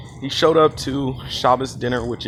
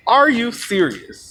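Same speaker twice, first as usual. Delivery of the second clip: higher, slow incredulous question; emphatic disbelief; 6 semitones higher, slower than usual. Retold or imitated. Storyteller repeating the question, appalled, higher and slower disbelief. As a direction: push the disbelief higher, drawing out each word.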